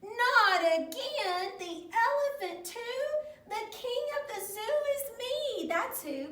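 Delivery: hammed-up indignant outburst; stomping frustration. A narrator's reading. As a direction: protest like a foot-stomping little king. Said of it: "Carlos stomped his foot in frustration".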